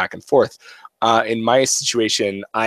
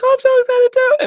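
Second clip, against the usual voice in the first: put-on much higher, bubbly girlish squeal; daughter excited; 7 dB louder than usual. Daughter to dad, squealing excited agreement. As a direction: gush right back. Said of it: "She's like".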